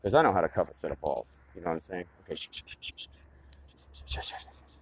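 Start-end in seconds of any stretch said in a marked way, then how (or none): 2.4-4.8 whispered shh, shh; shushing. Acted. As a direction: hush them in a whisper. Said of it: "I don't want him to hear me".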